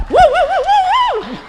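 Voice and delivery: high, loud woo cheers; excited cheering. Real, not acted. Welcoming Raheem up with loud high whoops. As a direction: whoop to hype up the crowd.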